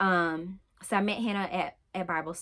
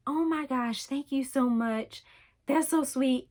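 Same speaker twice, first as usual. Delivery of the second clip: higher, bright thanks, a little overwhelmed; flattered gush; 6 semitones higher than usual. Retold or imitated. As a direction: gush back thanks like a surprised friend. Flattered reply to a compliment.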